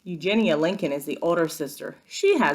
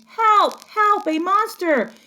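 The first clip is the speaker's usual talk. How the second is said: high, loud panicked cries; panicked scream; 6 dB louder, slower than usual. Acted. Baby screaming to her sister about the monster, high and loud. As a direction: scream for help in terror.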